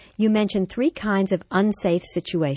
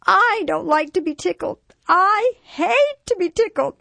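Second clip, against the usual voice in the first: put-on very high, loud, slowing child's protest; upset little boy; 6 dB louder than usual. A narrator's reading. Five-year-old to his mom, tearful protest. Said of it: "he announced, tears beginning to well in his eyes".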